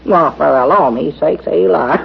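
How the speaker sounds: usual speaking voice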